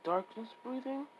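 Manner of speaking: Tanjiro. Acted. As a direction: repeat it back as a puzzled question. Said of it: "Tanjiro would be like".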